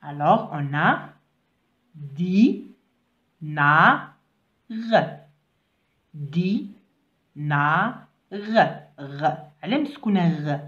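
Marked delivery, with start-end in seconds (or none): 2.0-4.9 "di, na, r" sounded out with gaps between; sound-by-sound reading. Shown as a demonstration. Teacher leading pupils through a word, syllable by syllable. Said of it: "suivez avec moi". 6.1-9.4 "Di, na, r" in separate pieces; sound-by-sound reading. Shown as a demonstration. Teacher repeating the word for pupils, syllable by syllable. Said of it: "suivez avec moi".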